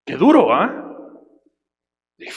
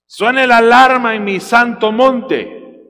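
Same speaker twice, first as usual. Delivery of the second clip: slow, loud scripture proclamation; preaching at full force; 6 semitones higher, 6 dB louder, much slower than usual. Acted. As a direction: thunder the verse to the church. (predicación lenta y fuerte)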